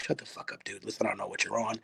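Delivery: whispered, dismissive brush-off; hushed scoff. Retold or imitated. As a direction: scoff under your breath at the stranger. Listener scoffing at preacher.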